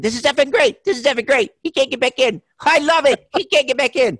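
high, loud mock glee; Joe Pesci. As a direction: impersonate a man crowing with delight at someone's predicament. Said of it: "so I kept saying".

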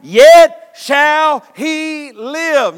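loud, slow proclamation of I am; booming declaration. Acted. Preacher voicing Jesus to Martha, loud and slow. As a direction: boom each word out.

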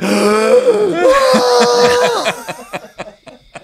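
loud, high mock gasp; wife's shocked gasp; horrified gasp. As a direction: gasp in terror. Wife's terror at fall.

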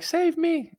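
very high mock falsetto plea; date in distress. Date calling to comedian from kangaroo's grip, mock-helpless falsetto. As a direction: squeal it like a hammed-up damsel. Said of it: "If a kangaroo took my date".

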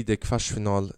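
ordinary talk

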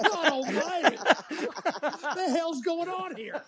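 laughing through the words, loud and high; cracking up in disbelief; exasperated laughing outburst. Real, not acted. Laughing at the argument.

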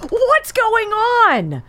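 laughs, then a high, slow what's going on; baffled, laughing at the clip; laughing disbelief. Real, not acted. Host hearing the president yell, laughing in disbelief. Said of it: "reacting to the yelling clip".

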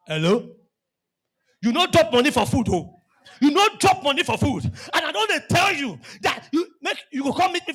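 mock scolding married wife; blunt married wife. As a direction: snap it like a fed-up wife. Same girl once married.

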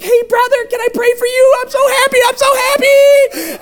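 very high squeaky mock-happy voice; overjoyed program member. Program member offering to pray for newcomer, overjoyed. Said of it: "that you're so happy".

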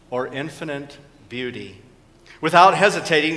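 usual speaking voice

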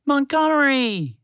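high, loud, stretched cry of joy; joyful shout. A narrator's reading. Mouse to moose, shouting with joy at seeing him wake. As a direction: call out to your friend overjoyed. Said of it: "shouted with joy".

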